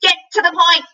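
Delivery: high, loud squawking bird voice; bird's shriek. A narrator's reading. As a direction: screech it like a frantic bird.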